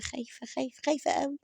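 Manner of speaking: softly whimpering mock fear; mock fearful. Mocking Hanaa's fear on the scan bed, whimpering softly. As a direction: mimic her being scared, babyish. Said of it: "وهي خايفة".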